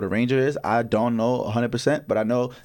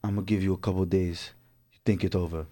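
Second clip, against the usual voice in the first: put-on lower, softer Russian hitman accent; calm professional Russian hitman, lower and softer; imitating a calm Russian hitman accent, lower and softer; 6 semitones lower, 6 dB quieter than usual. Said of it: "you put in the Russian accent".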